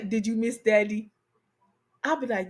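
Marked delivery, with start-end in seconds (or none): 0.0-1.1 low, soft imitation of her man; partner's voice. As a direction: lightly play the man cooing, pitched down. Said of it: "when he say".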